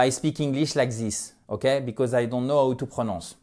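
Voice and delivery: English with French vowels and even syllables; bad French-accented English. Shown as a demonstration. Teacher imitating what learners must stop doing, French accent. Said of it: "Je veux plus que vous prononciez".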